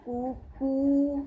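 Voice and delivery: कू, कू drawn out very slowly; a very slow, held कू, कू; slow dictation. Shown as a demonstration. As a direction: dictate कू कू slowly for the class to write. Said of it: "जैसे जैसे मैं बोल रही हूँ".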